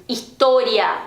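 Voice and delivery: história with a narrow, closed "oh". Shown as a demonstration. Teacher showing learners Spanish história, closed o. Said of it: "não tem esse O abertão".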